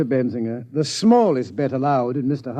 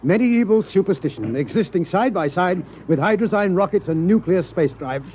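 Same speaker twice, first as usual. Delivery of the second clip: ordinary talk, nothing marked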